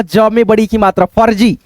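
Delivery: normal talking voice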